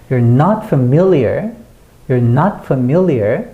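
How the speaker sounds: slow, strong "not" and middle of "familiar"; light "you're", punch "not" and the "mil" of "familiar"; stress NOT and MIL. Shown as a demonstration. Teacher drills the phrase, heavy stress on "not" and "familiar". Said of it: "not은 크게 강조해 주셔야".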